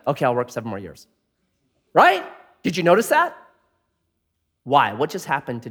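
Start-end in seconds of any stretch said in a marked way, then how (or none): 0.1-1.1 meek surrender. Retold or imitated. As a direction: lower and fast, deflated. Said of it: "goes from red hot, just raging to".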